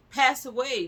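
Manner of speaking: high and loud in disbelief; angry cry about the lady's death; outraged shout. Real, not acted. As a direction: hurl it out in indignation. Outraged at the doctors.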